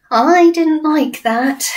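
put-on high, slow shaken little girl; Sophie's voice. Sophie telling the BFG after being tossed, high and slow. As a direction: let the fright settle in her voice.

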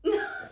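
short, loud high laugh. Acted. Friend amused by Louise's question, laughing loudly. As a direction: burst out in a quick high laugh.

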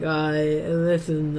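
slow, thick-tongued Boston accent attempt; slurred, slow Bostonian; drugged-tongue Boston accent. Shown as a demonstration. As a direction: talk Boston as if your tongue were drugged. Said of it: "drugs and then your, your tongue was like".

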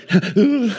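high, loud outburst; mock meltdown sound; imitated freak-out. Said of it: "and then I freaked out".

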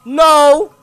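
loud frantic pleading; panicked no. Real, not acted. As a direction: beg in a panic, loud and high. Panicking at a monster.

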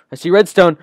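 loud, thrilled cry; excited shout. Real, not acted.

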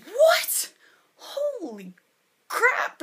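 sudden high loud yelp of disbelief; stunned shock. Real, not acted. Vlogger shocked that her first video is a year old, yelping. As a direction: blurt it out, caught off guard.

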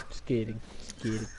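teasing quietly. Real, not acted. Teasing soft after fake warning.

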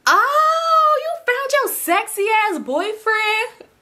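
high, drawn-out singsong tease; teasing squeal. Retold or imitated. Friend greeting her about her boyfriend, teasing squeal. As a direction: squeal it out, stretching the words like a taunt.

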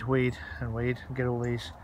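ordinary delivery

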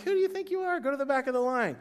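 mock outrage at someone cutting in line; indignant line-cutter retort. Churchgoer to a line-cutter, mock-indignant high squeal. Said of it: "You're like".

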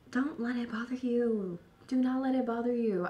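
hushed, soothing advice; quietly calm the listener down; soft reassurance. Real, not acted. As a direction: drop to a gentle, soft voice. Soothing advice to someone hurt.

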